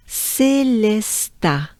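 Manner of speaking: "Célesta" very slow, syllable by syllable, ending open "tah"; French pronunciation. Shown as a demonstration. Teacher saying the name together with learners, slowly. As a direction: draw out "Célesta" for repeating. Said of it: "So let's do it together".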